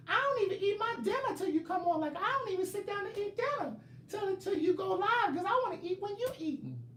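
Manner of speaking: very high squeaky complaining voice; mocking a fan's voice. Mocking Bianca rushing home for the live, squeaky high whine. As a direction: whine like an impatient fan. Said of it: "that's the octave that y'all speak in".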